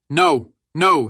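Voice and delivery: loud, high cry of protest; alarmed, emphatic yell; shouted refusal. A narrator's reading. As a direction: shout after a deserting man. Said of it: "shouted the Stabs".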